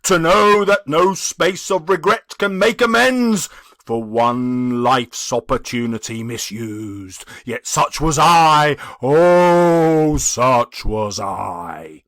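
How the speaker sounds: loud, slow spectral lament; a restless soul mourning its fate; anguished ghost. Acted. The ghost speaks.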